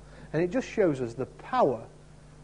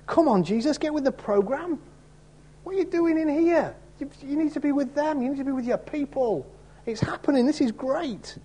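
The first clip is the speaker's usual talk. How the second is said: eager Peter; 9 semitones higher than usual. Retold or imitated. Peter urging Jesus back.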